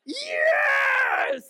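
huge high shout of pure joy; ecstatic kid yell. Retold or imitated. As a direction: scream it like a kid tearing open a gift. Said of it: "It looks more like that".

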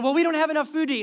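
high, loud imitation of grumbling; mock complaining. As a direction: complain like a hungry, ungrateful crowd. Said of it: "They actually kept complaining".

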